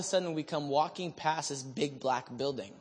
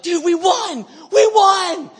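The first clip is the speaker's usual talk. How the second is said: high, loud, jubilant yell; victory shout; 14 semitones higher, 12 dB louder, slower than usual. Retold or imitated. To his friend after the battle, victory shout. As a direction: yell it like you just won the battle.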